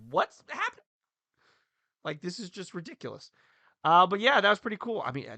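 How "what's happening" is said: high-pitched baffled squeal; mock bewilderment. Fan seeing a giant teddy bear carried past, high mock bewilderment. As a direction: react as a stunned onlooker, pitched way up. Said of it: "you're just like".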